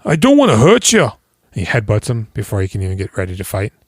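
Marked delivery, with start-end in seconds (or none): 0.0-1.1 higher, louder, cheeky warning; mock a cocky Irish fighter; cocky boxer. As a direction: boxer warning his opponent off. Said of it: "He's like a leprechaun".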